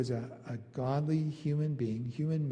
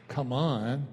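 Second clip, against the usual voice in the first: cocky. Retold or imitated. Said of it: "cocky attitude".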